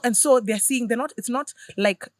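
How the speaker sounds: ordinary talk, nothing marked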